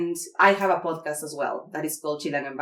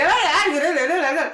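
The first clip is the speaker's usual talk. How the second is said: imitating Elvia; 10 semitones higher, 5 dB louder than usual.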